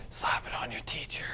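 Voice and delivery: sneaky whispered aside; whispering. Acted. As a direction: whisper it like a secret. Whispered commercial punchline.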